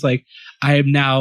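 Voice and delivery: normal talking voice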